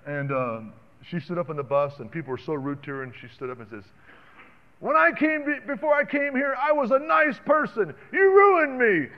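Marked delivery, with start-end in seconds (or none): 4.8-9.1 put-on much higher, louder child's voice; daughter's outburst. Little girl to rude Israelis on the bus, high indignant outburst.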